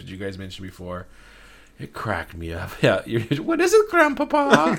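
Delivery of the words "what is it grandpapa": high, loud fake German accent; mock German accent, pitched up. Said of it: "the real and fake German voices".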